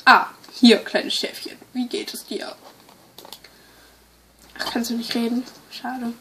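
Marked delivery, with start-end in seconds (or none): 0.8-2.6 soft unvoiced whisper. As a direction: whisper it to a little lamb. Whispering to a lamb.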